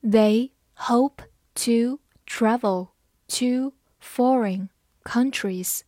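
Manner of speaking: slow, words pulled apart; unhurried model reading; slow read-along. Shown as a demonstration. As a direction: read it slowly for learners. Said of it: "慢慢来读".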